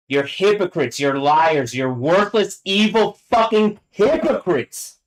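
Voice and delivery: building to a high, slowed, furious finish; real angry outburst. Real, not acted. Ranting at people she calls hypocrites, real fury. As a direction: let the accusations climb into a drawn-out yell.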